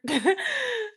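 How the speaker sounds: high-pitched burst of laughter; loud laugh. Real, not acted. Speaker cracking up at their own lie, real loud laugh.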